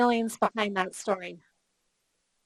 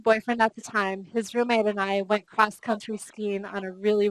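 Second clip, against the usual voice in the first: ordinary talk, nothing marked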